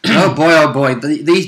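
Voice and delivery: ordinary delivery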